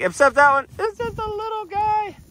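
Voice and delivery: high cooing to a small calf. Real, not acted.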